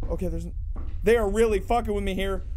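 usual speaking voice

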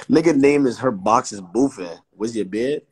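ordinary talk, nothing marked

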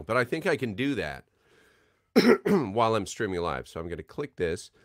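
ordinary delivery

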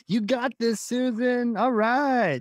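high-pitched, hammed-up encouragement; exaggerated hype voice; mock pep talk. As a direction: cheer someone on with absurd pep. Joking about mismatched peppy tone.

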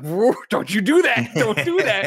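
high, slow mock guard voice, laughing through it; startled guard. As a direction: play the jumpy security guard warning him off, half laughing. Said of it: "and the guy's like".

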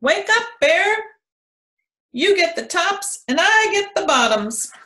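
high, loud wake-up call. A narrator's reading. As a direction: shout up at the sleeping bear. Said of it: "Hare called out".

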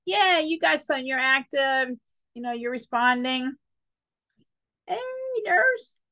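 put-on high, lively baby calling nurse. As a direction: call out like an active newborn.

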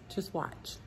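breathy whisper to the camera; conspiratorial whisper. Real, not acted. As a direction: hush it down, just breathe the words. Whispered aside.

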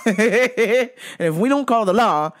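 laughing through the words, slowed down. Real, not acted. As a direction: chuckle while you say it. Laughs at own outrage.

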